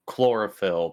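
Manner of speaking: usual speaking voice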